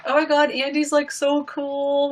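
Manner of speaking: mock teen girls raving about their idol; gushing girls. The girls at Mac Chat raving about Andy, gushing. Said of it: "the girls are just like".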